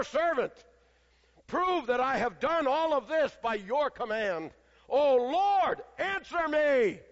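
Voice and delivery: high, loud, slowed plea; pleading loud prayer. Retold or imitated. Elijah pleading aloud with God before the people. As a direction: pray it out loud and slow, urging. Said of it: "he was not quiet".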